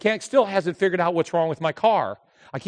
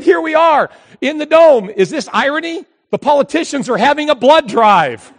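higher and louder, half laughing; excited vlog commentary; 7 semitones higher, 6 dB louder than usual. Real, not acted. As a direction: hype it up for the camera. Filming himself, joking.

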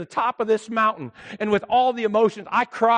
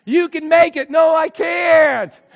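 loud, high, strained protest; exhausted outcry; 9 semitones higher, 9 dB louder, slower than usual. Retold or imitated. Worn-out pastor near the summit crying out to himself, loud and strained. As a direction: cry out that you can't go on.

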